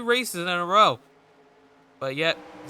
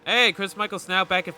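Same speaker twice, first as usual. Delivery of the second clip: normal talking voice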